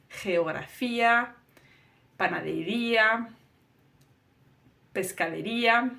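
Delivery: Geografía, panadería, pescadería with the stress on the í of -ía; stressed ía ending. Shown as a demonstration. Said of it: "This ia sound that you hear at the end".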